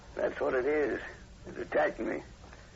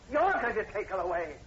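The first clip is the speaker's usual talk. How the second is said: higher and louder, incredulous; furious repeat; 8 semitones higher, 4 dB louder than usual. Acted. Horton to the sergeant, furious at the plan to take Julie. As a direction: throw his words back at him in rage. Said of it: "Over my dead body".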